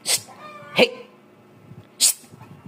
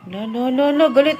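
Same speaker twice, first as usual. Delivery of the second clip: usual speaking voice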